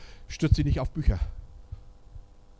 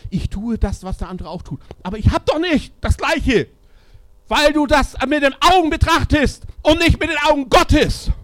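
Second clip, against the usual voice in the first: much higher and louder, crying out; impassioned shout; 13 semitones higher, 10 dB louder than usual. Real, not acted. Preacher shouting to discouraged leaders, impassioned. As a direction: shout it over the whole church.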